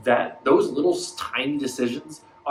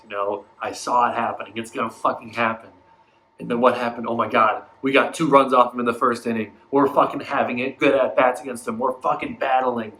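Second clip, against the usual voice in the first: normal talking voice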